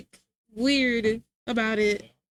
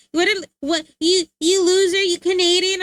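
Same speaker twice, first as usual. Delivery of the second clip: louder, higher sputtering jeer; imitating a furious gamer, higher and louder; mock angry taunt; 5 semitones higher, 5 dB louder than usual. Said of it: "They're like".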